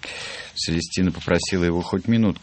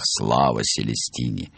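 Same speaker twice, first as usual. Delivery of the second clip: ordinary delivery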